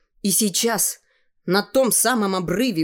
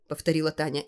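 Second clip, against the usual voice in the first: ordinary delivery, nothing marked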